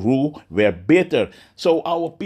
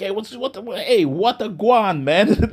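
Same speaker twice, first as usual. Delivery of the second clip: higher, lively Jamaican greeting; Jamaican accent; 7 semitones higher, slower than usual. Retold or imitated. Preacher voicing the Heavenly Father, Jamaican accent, pitched up. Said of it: "Like the Jamaican".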